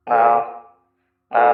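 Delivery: ordinary delivery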